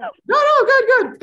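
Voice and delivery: high and loud, laughing along; excited. Real, not acted. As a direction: burst out cheerfully. Laughing reply to guest.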